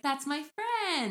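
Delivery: put-on drawn-out, high little-boy voice; sweetly stretched high child voice. Little boy proudly claiming his bird friend, high child voice. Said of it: "he was like".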